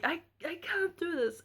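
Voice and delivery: high, pleading cry of defeat; exhausted mother pleading with the midwife, high and desperate; desperate plea. Retold or imitated. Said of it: "the first time I had said".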